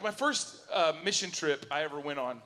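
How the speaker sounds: usual speaking voice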